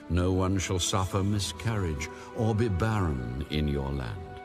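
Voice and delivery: ordinary talk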